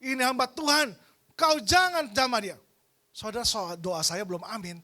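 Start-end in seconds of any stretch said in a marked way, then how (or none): 0.0-2.6 loud, high commanding prayer casting out a spirit; authoritative rebuke. Retold or imitated. Pastor rebuking the spirit of death over a comatose man, loud commanding cry. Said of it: "Saya berdoa dengan otoritas".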